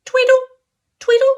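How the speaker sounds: high chirpy birdsong; bright chirping chickadee call; chickadee tweedle. Acted. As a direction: chirp it high like a little bird. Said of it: "songs had sounded like tweedle".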